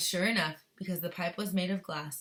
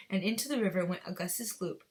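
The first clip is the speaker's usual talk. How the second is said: ordinary delivery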